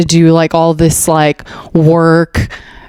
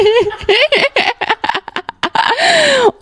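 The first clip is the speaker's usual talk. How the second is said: long squeal of laughter, pitched up; high squealing laugh. Real, not acted. Reading the Taliban article, squealing laugh. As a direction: let out a high squealing cackle.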